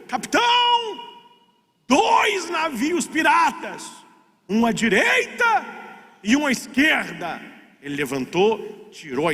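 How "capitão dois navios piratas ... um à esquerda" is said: retold warning shout. Sailor wakes the captain about pirate ships, shouting. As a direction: hail the captain with urgent news. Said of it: "o cara gritou".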